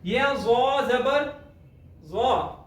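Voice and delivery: ज़ा जबर ज़ा spelled slowly; drawn out, ज़ा जबर ज़ा; slow careful recitation. Shown as a demonstration. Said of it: "तो के बाद जो ज़ा आती है". (धीरे धीरे ज़ा जबर ज़ा)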